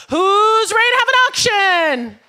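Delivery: loud, slow rallying call to the crowd; hype-up shout. Real, not acted. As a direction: whip the room up, drawn out. Hyping crowd before auction.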